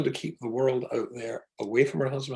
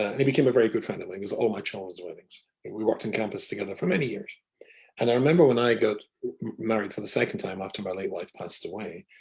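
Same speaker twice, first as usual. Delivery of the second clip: usual speaking voice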